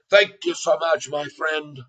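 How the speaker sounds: put-on Irish accent. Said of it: "from my deep Irish heart".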